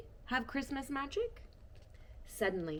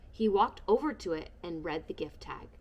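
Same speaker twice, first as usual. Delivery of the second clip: usual speaking voice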